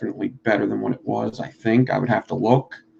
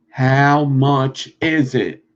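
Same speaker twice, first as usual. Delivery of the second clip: slowed down; 3 dB louder, much slower than usual. Shown as a demonstration. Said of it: "slow reply".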